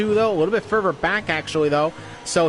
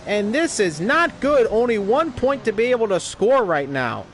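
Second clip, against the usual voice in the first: motorsport commentator; 5 semitones higher than usual. Acted. Crash play-by-play.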